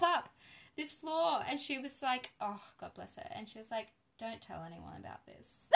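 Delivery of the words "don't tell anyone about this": hushed, secretive retelling; very soft, low-key aside; confidential plea. Retold or imitated. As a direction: drop right down as if sharing an embarrassing secret. Midwife's retold embarrassed plea.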